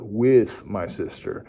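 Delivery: slow, stretched "with" leading into "my sister"; stress on with. Shown as a demonstration. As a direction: hit and hold the word "with". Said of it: "Emphasize that word".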